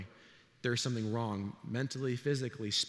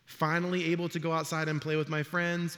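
ordinary talk, nothing marked

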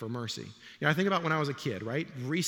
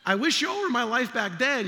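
loud, high laughing aside; 10 semitones higher, 8 dB louder than usual. Real, not acted. Pastor laughing with the congregation about his childhood. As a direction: laugh it out at the memory.

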